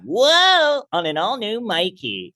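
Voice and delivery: high, hammed-up kids' TV announcer; mock promo with a squealed opening; Nickelodeon promo. Comic announcing the Mikey show, hammed-up promo voice. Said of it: "It does feel very Nickelodeon".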